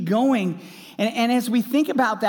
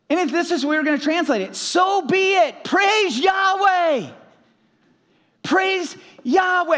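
fervent sermon climax; preaching at full force; 10 semitones higher, 4 dB louder than usual. Acted. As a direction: shout the praise like a revival preacher.

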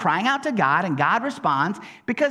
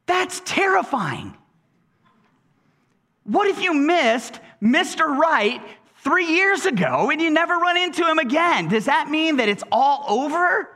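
retold alarm; 6 semitones higher than usual. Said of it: "And I was like".

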